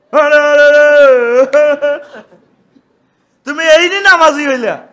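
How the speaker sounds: loud jeering at the prophet; mocking townsfolk. Townsfolk mocking the prophet Shuaib, loud sneer. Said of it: "قالوا يا شعيب".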